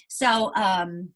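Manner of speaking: normal talking voice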